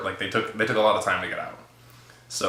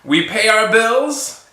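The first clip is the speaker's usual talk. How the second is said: laughing insistence; 11 semitones higher, 4 dB louder, slower than usual. Retold or imitated.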